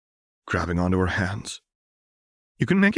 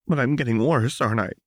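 higher, worried character voice; anxious question; 6 semitones higher, 3 dB louder, faster than usual. Acted. Jinx to Lux about getting worse, anxious and high. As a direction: plead for an answer in a raised pitch.

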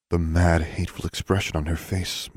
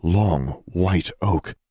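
plain reading voice

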